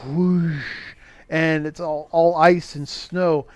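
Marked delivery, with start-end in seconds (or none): 0.0-0.6 drawn-out rush of wind; whoosh sound effect. Retold or imitated. Film reviewer imitating a door opening on a storm, whooshing. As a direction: imitate an icy blast rushing in. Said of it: "it's the classic whoosh".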